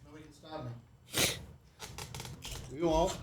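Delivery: talking while chewing. Real, not acted. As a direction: chew through the words. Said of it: "now I can chew as much as I want".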